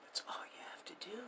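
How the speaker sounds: fast hushed whisper. Real, not acted. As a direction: drop to a quick secret whisper. Urging listeners to simply believe.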